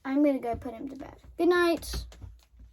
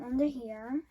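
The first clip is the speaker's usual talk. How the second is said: ordinary delivery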